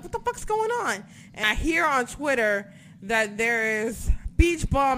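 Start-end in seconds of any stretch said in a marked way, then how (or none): 0.0-1.0 confused outburst. Retold or imitated. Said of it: "I'm like".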